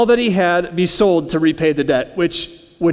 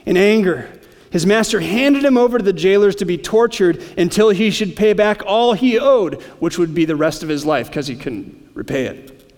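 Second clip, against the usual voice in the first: ordinary talk, nothing marked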